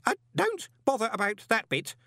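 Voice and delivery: put-on high, quick old Groat; eagerly cutting in; excited. A narrator's reading. Groat cutting off the golem, excitedly. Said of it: "said Groat excitedly".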